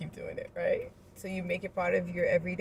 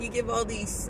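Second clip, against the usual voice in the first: normal talking voice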